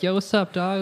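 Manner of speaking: high, breezy New York street greeting; street slang greeting. Retold or imitated. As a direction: greet him like a buddy on the block. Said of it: "then you're like".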